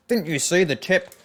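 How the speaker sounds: lightly mock a scolding player; mock indignation. Streamer imitates players who hate getting voted up, mock indignant.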